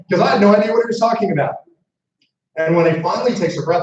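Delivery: normal talking voice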